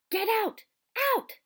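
high, loud enraged yell; teacher screaming the boy out of the room; furious shout. Acted. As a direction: throw him out with a roar. Snape furious at Harry.

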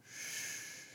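long soft shush; hushing shh. Acted. God hushing a freak-out, soft shh. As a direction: shush with breath alone. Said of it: "This is my universal sign with my girls".

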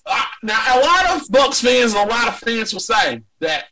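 ordinary delivery

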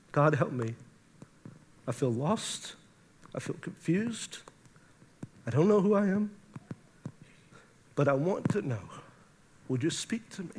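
hushed, halting prayer, choking up; quiet plea, fading to a whisper; emotional. Real, not acted. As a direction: pray softly through real emotion. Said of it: "I don't know why I'm so emotional".

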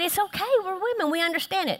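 high-pitched imitation of women comforting; mock consoling. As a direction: coo it high, playing the understanding girlfriend. Voices women comforting each other.

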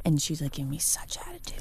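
hushed whisper, no voice; library whisper. Real, not acted. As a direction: whisper so nobody next door hears. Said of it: "I love that you guys are whispering".

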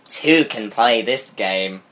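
slow, hammed-up menace; mock dramatic. A narrator's reading. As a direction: read it like a cheesy villain. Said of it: "reading the cheesy line".

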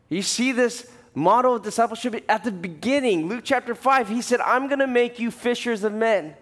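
high, loud preaching climax; full-force preaching. Acted. Preacher rallying the congregation to go make disciples, loud and high. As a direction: pastor preaching the great commission to church, at full force.

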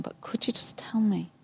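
a tiny whispered request to God; whispered plea. Retold or imitated. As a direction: whisper the ask, hoping.

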